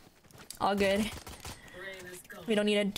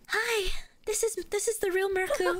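much higher put-on little voice; joke "real voice"; 9 semitones higher than usual. Streamer jokingly reveals a real voice to teammate, mock high voice. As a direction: pitch it way up as a pretend real voice. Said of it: "let me just talk my real voice".

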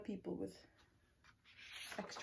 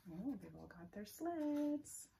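usual speaking voice

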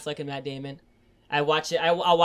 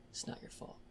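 whispered comfort; 18 dB quieter than usual. Retold or imitated. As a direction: barely voice it, like comforting someone. Robin Williams' line retold.

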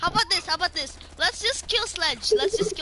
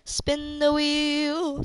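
slow, drawn out; much slower than usual. Real, not acted. Repeats slowly to teammate.

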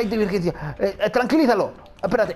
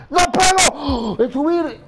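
frustrated shout; 6 semitones higher, 4 dB louder, slower than usual. Real, not acted. Player failing a timed climb.

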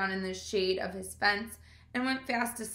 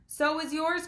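mild cry; 7 semitones higher than usual. Acted. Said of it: "cried Avery".

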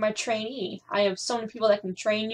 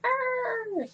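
mock scream. Mocking screaming NPCs.

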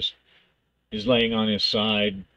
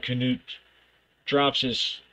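normal talking voice

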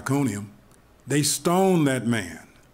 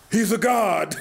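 higher, amazed exclamation; amazed cry; 8 semitones higher, 3 dB louder than usual. Retold or imitated. Islanders realize Paul survived the bite, amazed. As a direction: gasp out the discovery in awe.

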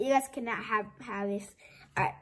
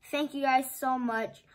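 usual speaking voice

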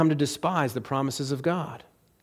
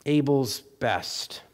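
ordinary talk, nothing marked

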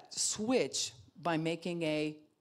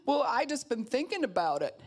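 hesitant congregant; 8 semitones higher, 5 dB louder than usual. Retold or imitated. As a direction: stammer out a lame excuse. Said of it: "what kind of prayers".

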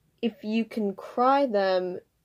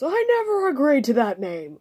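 gruff grown man calling out, higher; 5 semitones higher, 4 dB louder than usual. Acted. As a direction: call it out gruffly from another room. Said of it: "a gruff voice called from outside the room".

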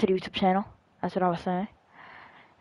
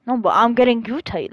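ordinary talk, nothing marked

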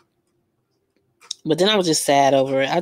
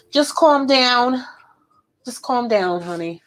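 slow sassy calm down; 6 semitones higher, slower than usual. Shown as a demonstration. Vlogger telling viewers to calm down, slow and sassy. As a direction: soothe them with exaggerated sass.

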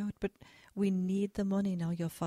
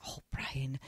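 whispering; 7 semitones lower, 8 dB quieter than usual. Acted. Mother gently chiding her son.

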